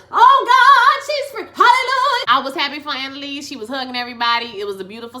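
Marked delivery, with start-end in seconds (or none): 0.1-2.2 high, loud praise shout; shouting praise for her freedom; joyful shout. Retold or imitated. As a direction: rejoice like in church. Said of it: "Annalise is".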